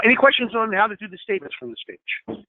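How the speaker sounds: usual speaking voice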